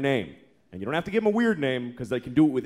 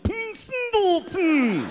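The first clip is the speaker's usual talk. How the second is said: silly drawn-out name; 3 dB louder, much slower than usual. Pretend.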